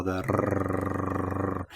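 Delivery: a rolled "rrrr", low and soft; trilled R demo. Shown as a demonstration. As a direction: roll the "rrrr" with the tongue tip. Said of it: "the trilled R".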